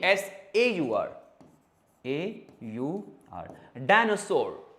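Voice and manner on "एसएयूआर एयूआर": S-A-U-R spelled very slowly; slow spelling. Shown as a demonstration. Teacher spells the ending for pupils. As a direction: draw out each letter. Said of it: "एसओ भी नहीं".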